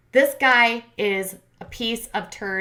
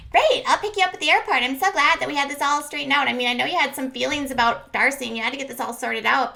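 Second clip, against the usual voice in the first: high, sugary fake enthusiasm; over-eager mock girlfriend; mocking gushy girlfriend; 5 semitones higher than usual. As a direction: gush sweetly in a mock higher voice. Mocking imitation of Shannon's gushing reply.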